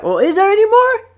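high, hopeful cartoon bear; imitated Winnie the Pooh. Bear asks rabbit for seconds, high hopeful imitation. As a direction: ask it high, rising to the end. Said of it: "And he's like".

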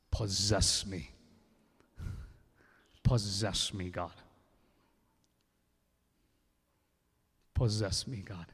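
a preacher crying out in prayer; pleading prayer. Acted. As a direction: beg God to take over.

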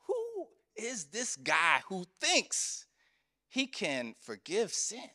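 slow, incredulous sneer; mock the idea with disbelief; lightly voiced doubting scribes; scornful questioning. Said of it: "They start questioning Jesus".

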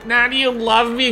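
high, hopeful question; a lightly voiced girl asking for love; a hint of a naive girl; smitten girl. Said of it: "she's like".

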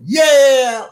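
high, loud kiddie cheer; mock cheer. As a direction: cheer like a bunch of excited kids. Family cheers in retold scene.

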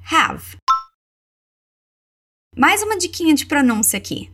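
ordinary delivery

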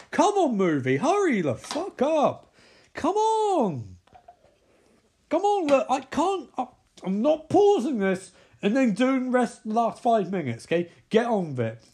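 high-pitched impatient pleading; real, strained exasperation; exasperated urging. Real, not acted. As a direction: hurry the film along, fed up. Urging slow movie before recording cutoff.

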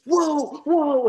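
high, loud mock-startled "whoa"; alarmed, high-pitched outburst, acted out; startled shout. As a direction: yelp it as if the gun just went off. Retold accidental gunshot, startled gunman.

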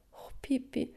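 faint, breathy plea; desperate whisper. Acted. Annika pleading with Pippi, soft desperate whisper. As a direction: barely dare to ask, near tears.

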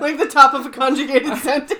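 talking through laughter; laughing. Real, not acted. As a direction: giggle through the words. Joking about conjugation, laughing.

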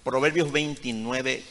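ordinary talk

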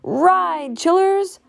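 high, urgent rallying call; crying out. A narrator's reading. Grandude urging children from a stampede, crying out. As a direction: urge them on in a high call. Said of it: "cried Grandude".

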